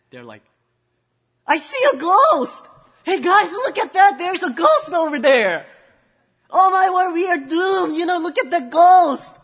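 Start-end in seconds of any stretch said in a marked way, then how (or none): 1.5-9.4 high, loud panicked cries; hammed-up terror at a ghost; panicked shouting. Disciples in the boat spotting a ghost, panicked shrieking. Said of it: "And now they start to panic".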